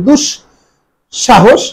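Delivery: দুঃসাহস as "dush... sha-hosh", with palatal sh; palatal sh pronunciation. Shown as a demonstration. Teacher explains the sh sound, saying দুঃসাহস in separated parts. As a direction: break দুঃসাহস after a hushed-sh "dush". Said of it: "তালব্য সহ উচ্চারণ করি".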